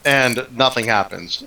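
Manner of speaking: ordinary talk, nothing marked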